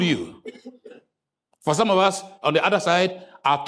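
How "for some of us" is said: usual speaking voice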